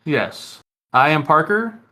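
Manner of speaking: ordinary delivery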